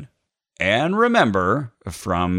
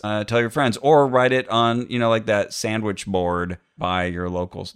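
usual speaking voice

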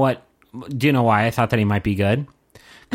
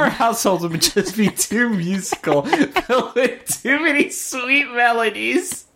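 mock prim boast; 9 semitones higher than usual. Mocking the woman's boast.

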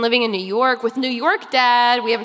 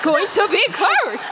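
higher, hammed-up distressed queen; mock panicked queen; 7 semitones higher, slower than usual. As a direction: gasp it out like a melodramatic mother. Queen Amber panics about curse.

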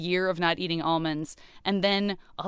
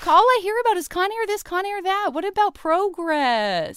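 nagging Karl Marx; 11 semitones higher, 3 dB louder than usual. Pretend. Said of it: "Karl Marx".

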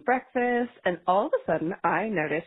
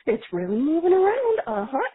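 higher, sing-song "isn't this neat" voice; mock calm parent; 8 semitones higher than usual. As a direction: fake cheer to keep a child calm. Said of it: "we stay completely calm".

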